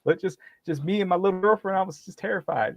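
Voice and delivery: high fake-frightened whine; mock terrified. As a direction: play the frightened one to the officer. Continues mock frightened victim.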